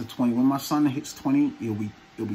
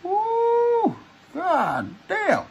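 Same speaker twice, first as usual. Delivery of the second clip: high pained squeal; 3 dB louder, much slower than usual. Real, not acted. Pulling the mask off.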